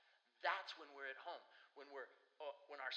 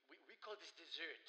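lightly voiced Frenchman correcting his host; a hint of mock-French disdain; imitated French friend. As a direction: play the visitor making his point. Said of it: "friend from France".

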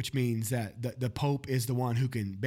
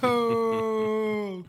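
much higher drawn-out groan at the pun; mock groan; 10 semitones higher, 4 dB louder than usual. Real, not acted. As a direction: groan at a bad joke.

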